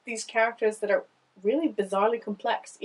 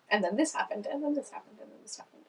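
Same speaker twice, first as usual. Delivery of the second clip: hurried list voice; 2 semitones higher, 8 dB quieter, faster than usual. Shown as a demonstration. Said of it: "It felt much more deliberate".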